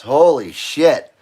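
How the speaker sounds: words broken by hiccups. Real, not acted. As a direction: talk through the hiccups. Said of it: "Now I got the freaking hiccups".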